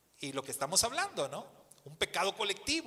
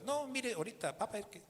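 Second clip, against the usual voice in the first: dismissive cashier; 6 semitones higher, 6 dB quieter than usual. Retold or imitated. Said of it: "volteó otra vez".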